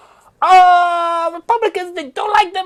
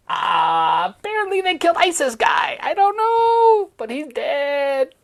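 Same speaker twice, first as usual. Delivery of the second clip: usual speaking voice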